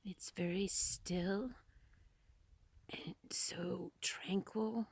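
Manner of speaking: a vlogger whispering in awe at the mountain view. Real, not acted. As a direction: whisper as if not to disturb the stillness. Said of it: "I don't know why I'm being so quiet".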